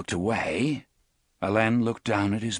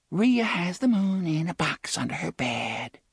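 put-on higher lilting little-girl voice; sing-song small child; 9 semitones higher than usual. A narrator's reading. Entranced girl tells the boys a secret, sing-song child voice. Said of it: "She lilted this in the sing-song voice of a small child".

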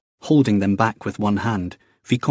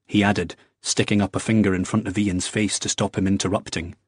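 ordinary delivery, nothing marked

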